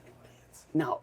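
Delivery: very soft, near-whispered mock command; retold order under the breath; hushed order. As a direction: imitate an instructor hissing orders in church. Maniac instructor secretly ordering during church.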